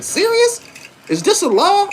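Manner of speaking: high, loud incredulous question; "Serious?" shot up high in mock shock; loud disbelief. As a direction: sound stunned that it is a law. Disbelief at the jaywalking rule.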